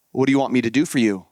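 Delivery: low, quick, gentle question; a calm teacher asking kindly; lightly voiced Jesus; Jesus speaking. A narrator's reading.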